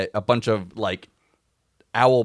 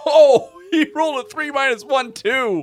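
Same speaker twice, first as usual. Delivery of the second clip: high, loud, delighted outburst; laughing glee; 5 dB louder than usual. Real, not acted. Game master revealing a terrible roll, laughing outburst. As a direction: crow the bad roll through laughter.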